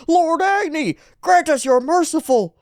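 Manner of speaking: high, fervent mock plea; pleading worshipper. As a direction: call upon the god for mercy. Character prays to fire god.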